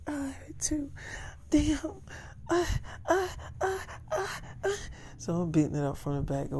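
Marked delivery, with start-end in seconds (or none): 0.1-2.7 high whisper. Acted. Pillow talk in bed. 3.1-4.7 high moans. Acted. Sex scene acted out.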